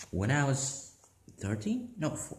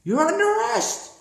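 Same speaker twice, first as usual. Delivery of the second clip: police shout; 11 semitones higher than usual. Pretend. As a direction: bark the arrest like a cop. Police enemy shows up.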